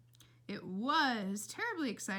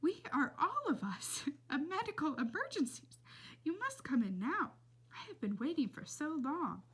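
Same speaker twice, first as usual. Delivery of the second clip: put-on old lady voice; 6 semitones higher than usual. A narrator's reading. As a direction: croon it like a sweet old lady. Old lady speaks, laughing.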